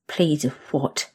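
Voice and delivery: plain reading voice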